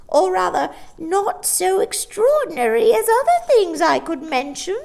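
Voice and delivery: very high, loud shrill hinting; hint slyly; knowing old woman. A narrator's reading. Old shopkeeper hinting at magic to Mary Poppins.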